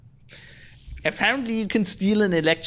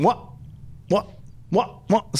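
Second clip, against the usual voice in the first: short, higher-pitched repeated interjection; rapid-fire imitation of a wrestler's taunt; Stone Cold catchphrase; 6 semitones higher, slower than usual. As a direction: cut each question short, with a beat between. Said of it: "Stone Cold just going".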